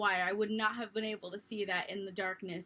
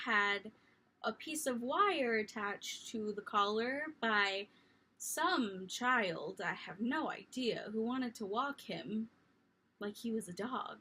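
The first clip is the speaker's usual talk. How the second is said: ordinary delivery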